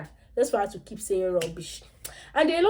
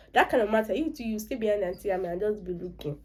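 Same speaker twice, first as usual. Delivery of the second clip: plain reading voice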